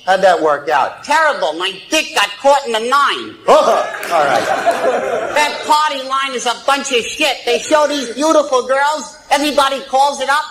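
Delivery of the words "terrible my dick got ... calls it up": crude puppet voice, cackling; cheeky dummy voice, higher than the ventriloquist; squeaky ventriloquist dummy. Acted. Ventriloquist act, dummy speaking.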